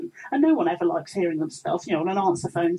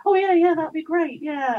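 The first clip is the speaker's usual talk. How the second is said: mock eager; 8 semitones higher, 4 dB louder than usual. Mock enthusiastic brush-off.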